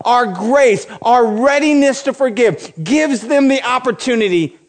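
high, driving sermon delivery, hammering each repeated phrase; impassioned preaching. Acted. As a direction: preach it with rising urgency, high-pitched. Preaching at full force.

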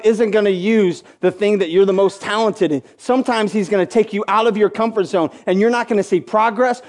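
ordinary talk

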